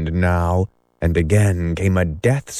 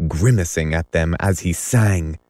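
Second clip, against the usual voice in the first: usual speaking voice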